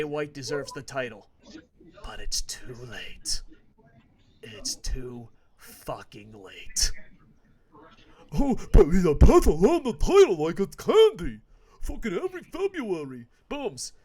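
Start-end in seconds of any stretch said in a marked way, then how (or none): 2.0-6.9 slow hushed whisper. Real, not acted. Ominous whisper to listeners that it's too late. 8.3-13.9 much louder, higher mocking rant. Fan mocking the February title booking, loud mock rant. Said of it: "mocking booking".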